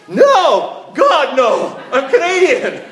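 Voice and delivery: high, loud, laughing denial; Canadian abroad hammed-up horrified shout; mock horrified shout. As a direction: shriek it, hammed-up horror at being called American. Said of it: "and you say".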